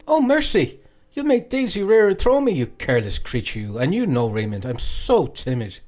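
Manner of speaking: high, coy mock fright; mock alarmed young woman. A narrator's reading. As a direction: squeal in pretend fear then go coy. Nora feigns fright on horse.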